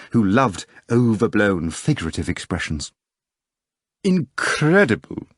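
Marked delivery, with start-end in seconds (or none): 4.0-5.3 slow, drawn-out amazed cry; excited exclamation. Acted. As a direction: marvel at it out loud. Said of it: "exclaimed the uninvited guest".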